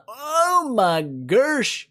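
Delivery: very slow, high drawn-out wail; hammed-up dismay. Real, not acted. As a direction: stretch every word in mock shock. Exaggerated reaction during the fight.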